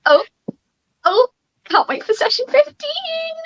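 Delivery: high, slow, drawn-out tease; put-on excitement, stretched out high; gleeful anticipation. Teasing a coming session, high drawn-out voice.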